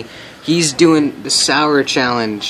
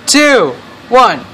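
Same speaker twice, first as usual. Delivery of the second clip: excited countdown; 10 semitones higher, much slower than usual. Real, not acted. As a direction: count him down with glee. Countdown before the chug.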